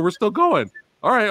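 ordinary talk

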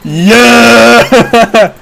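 high, long-held "yeah" yell; exultant cheer. Real, not acted. Streamer celebrating in the game, exultant cheer. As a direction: whoop it out in triumph.

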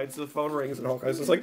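ordinary talk, nothing marked

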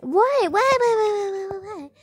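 high loud "wait, wait", fading; excited wait. Real, not acted. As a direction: rush out the waits excitedly. Excited sudden realization.

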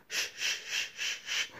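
long unvoiced shushing hiss. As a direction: shush everyone walking past, breathy and hushed. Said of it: "Bernie was over there just going".

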